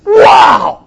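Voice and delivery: loud, high cry of amazement; loud, high astonished shout; awed exclamation. Real, not acted. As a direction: shout it in wonder. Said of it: "exclaims in awe".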